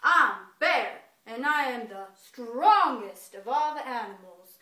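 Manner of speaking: big, loud boastful bear voice; bragging bear. Acted. Storyteller voicing Bear bragging to all the animals, loud boast. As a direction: boom it out as a proud bear. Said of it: "he starts bragging".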